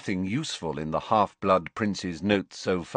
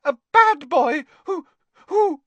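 much higher, louder furious elf squeak; an elf squeaking in outrage; angry squeak; 7 dB louder than usual. A narrator's reading. Dobby stammers in anger.

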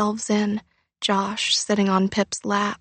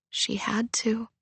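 hushed resolve; 7 dB quieter than usual. A narrator's reading. Narrator's resolve, unvoiced.

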